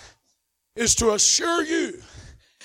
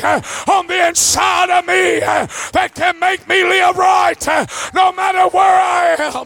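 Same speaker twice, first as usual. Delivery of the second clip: preaching; 4 semitones higher than usual. Acted. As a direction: preach it out to the whole house. Preaching to the congregation.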